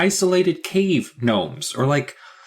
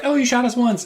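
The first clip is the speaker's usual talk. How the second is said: mock girl voice; 9 semitones higher than usual. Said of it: "It wasn't just like".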